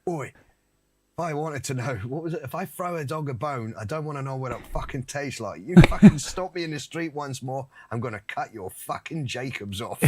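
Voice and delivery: a hint of a Cockney hardman; angry Cockney rant; London gangster. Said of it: "he was like".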